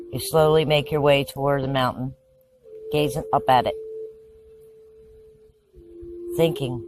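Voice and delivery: hushed, measured meditation voice; calm narration with lots of space between phrases; guided visualization. Acted. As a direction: guide them through the scene phrase by phrase, leaving long pauses.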